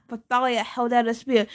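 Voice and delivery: usual speaking voice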